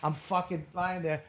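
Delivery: ordinary delivery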